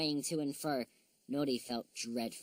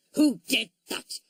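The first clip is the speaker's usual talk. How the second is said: very loud, slow, higher angry bark; furious dog with egg on his head; outraged yell; angry shout; 7 semitones higher, 11 dB louder than usual. A narrator's reading. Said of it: "angrily looking all around".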